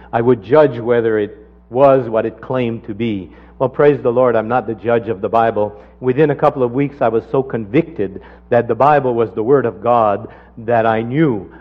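ordinary talk, nothing marked

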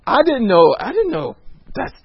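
high, loud amazed exclaim; amazed neighbor. Retold or imitated. Neighbor tells the couple what veggies did, amazed. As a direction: sound stunned by the news.

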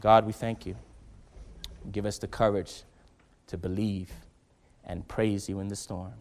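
low, soft prayer voice; closing prayer. Real, not acted. Pastor closing the service in quiet prayer. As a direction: pray quietly over them.

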